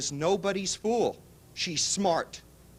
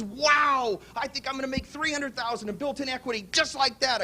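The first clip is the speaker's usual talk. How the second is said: high-pitched, imitated excitement over a big win; excited kid; 6 semitones higher than usual. Young investor marvels at three hundred thousand in equity, excited. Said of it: "He's excited, man".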